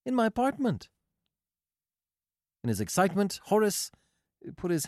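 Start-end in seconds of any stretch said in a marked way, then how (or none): 0.1-0.9 very high woman's character voice; Marcia's voice. A narrator's reading. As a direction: lift it right up for the female character. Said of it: "repeated Marcia".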